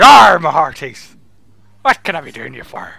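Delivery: loud hammed-up pirate growl; pirate captain greeting the party at his door. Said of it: "I'm trying to be a pirate".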